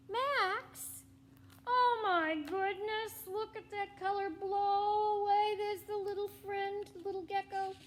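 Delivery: high, loud amazed exclaim; watching the color blow away; excited amazement. Real, not acted. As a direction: marvel out loud.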